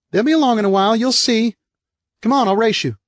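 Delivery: put-on high, bright-eyed kid whooping then chatting; little brother bragging about his fall; cheerful little boy. Eric voiced as a boy.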